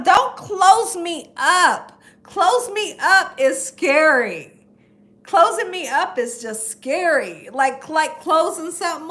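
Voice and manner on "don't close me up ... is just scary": slow dread. Real, not acted.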